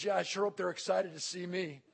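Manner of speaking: understated emotion of a retold soldier; emotional. Soldier on his family's welcome, mildly emotional. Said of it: "He gets emotional".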